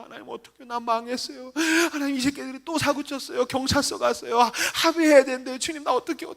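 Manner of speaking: high, wailing mock sob; acted crying prayer. Overwhelmed preacher complaining to God about troublemakers, tearful wail. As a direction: whine out the troubles as if weeping. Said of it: "맨날 우는 거".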